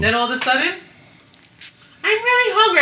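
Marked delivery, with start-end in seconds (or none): 2.0-2.8 put-on loud, high-pitched child's whine; mock kid voice. Tired child suddenly demanding food, loud mock whine. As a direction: imitate a kid announcing it at full pitch.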